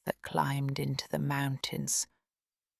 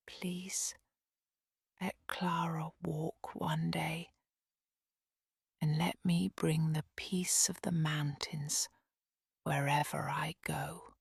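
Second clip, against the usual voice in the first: soft breathy whisper, a hushed bedtime prayer; 5 dB quieter than usual. A narrator's reading. Heidi alone at night praying for Clara, whispered. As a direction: pray under her breath so no one hears. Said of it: "she whispered a quiet prayer".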